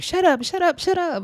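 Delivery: high, snapping "shut up" over and over; imitating a bully cutting someone off; mock shrill shutdown. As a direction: squeal it out to shut her down. Daisy antagonizing in fight.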